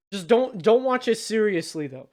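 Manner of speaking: normal talking voice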